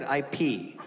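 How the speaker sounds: ordinary delivery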